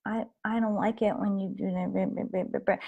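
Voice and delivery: put-on a timid kid barely getting the words out; unassertive child. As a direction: mumble it timidly, trailing away. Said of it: "not being so assertive".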